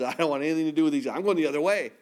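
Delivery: fast, brushing-off refusal; hurried refusal. Retold or imitated. Jonah refusing God's call, said fast and dismissive. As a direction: rush it like Jonah fleeing. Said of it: "Jonah says".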